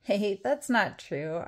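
usual speaking voice

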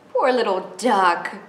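usual speaking voice

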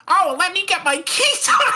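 very high, laughing mock hero; silly high cartoon voice. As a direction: squeal it with a laugh.

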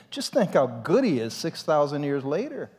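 ordinary delivery

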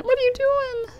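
squeaky high what-are-you-doing; high squeal at the dog. Real, not acted. Player squeals high at her dog in the bed. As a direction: pitch way up, baffled at the dog.

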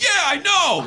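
high, loud puppet reply. Pretend. Ventriloquist's puppet admitting the joke, in a high loud voice. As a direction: answer as a sheepish dummy. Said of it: "He's like".

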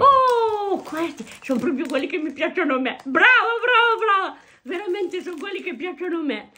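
high, loud delighted praise. Real, not acted. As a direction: gush over a favourite treat. Grandma delighted by favourite sweets.

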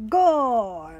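loud goal cry. A narrator's reading. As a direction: cheer the goal out. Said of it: "cry Danny and Pedro together".

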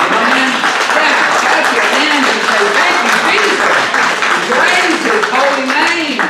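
loud, high shouts of praise; preaching shout. Real, not acted. Pastor urging church to clap for Jesus, exultant shout. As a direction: rouse the crowd at full voice.

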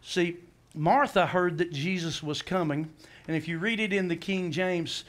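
usual speaking voice

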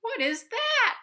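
surprised gasp. Acted. Narrator startled again.

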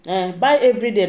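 ordinary talk

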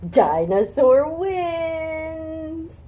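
high, loud, slow victory call; triumphant shout. Acted. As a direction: announce the winner, huge. Triumphant refrain.

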